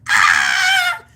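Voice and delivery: very high, loud shriek; panicked scream. Retold or imitated. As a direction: let out a terrified scream.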